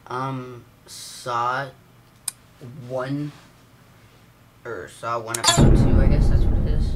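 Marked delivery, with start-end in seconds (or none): 5.4-7.0 excited outburst. Real, not acted. Gamer hyped by his pick, excited shout. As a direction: blurt it out, thrilled.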